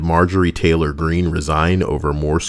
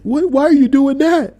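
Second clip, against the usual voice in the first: much higher, alarmed mock squeal; panicked friend; 3 dB louder than usual. Buddy alarmed at the wet-towel bat, high panicky voice.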